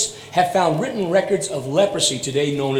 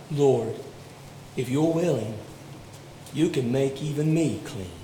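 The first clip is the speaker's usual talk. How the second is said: humble plea; 5 semitones lower, 9 dB quieter than usual. Retold or imitated. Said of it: "And so he cries out".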